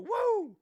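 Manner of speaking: high loud wrestler woo; piercing Ric Flair woo. Pretend. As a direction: scream a Nature Boy woo.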